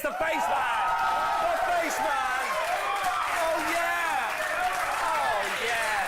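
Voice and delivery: excited shouting. Real, not acted. Host announces his hero is coming, excited shouting. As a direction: hype up the star's arrival with long, excited shouts. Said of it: "so excited".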